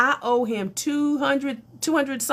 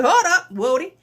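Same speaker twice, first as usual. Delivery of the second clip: higher, louder, laughing disbelief; hold up louder; 7 semitones higher, 6 dB louder than usual. Retold or imitated. Telling the reader he can't restrict her, loud laughing protest. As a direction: call out loud, half laughing.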